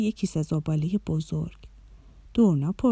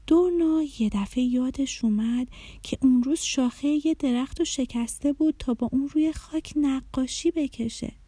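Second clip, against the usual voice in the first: ordinary delivery, nothing marked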